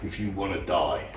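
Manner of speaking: low, hushed menacing threat; a quiet growl of warning, not a yell; understated indignant menace; mock righteous indignation. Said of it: "righteous indignation that says".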